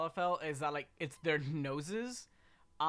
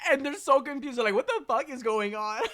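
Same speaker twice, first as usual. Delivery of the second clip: excited laughing gush; 10 semitones higher, 11 dB louder than usual. Real, not acted. Gushing, laughing at characters.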